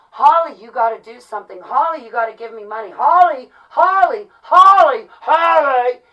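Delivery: hammered-up yelling of a name, mocking; mock demanding callers. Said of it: "for them to come tell me".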